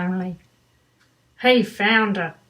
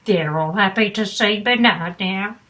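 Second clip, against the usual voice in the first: plain reading voice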